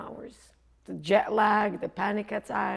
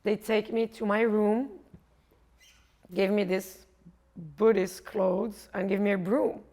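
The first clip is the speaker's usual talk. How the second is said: ordinary talk, nothing marked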